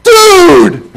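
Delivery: very high, loud, falling "Dude!"; shouted dude. Retold or imitated. Reading the friend's all-caps message, shouted. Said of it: "all capital letters, exclamation points".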